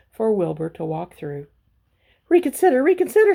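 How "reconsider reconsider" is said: much higher, loud pleading goose. A narrator's reading. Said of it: "said the goose".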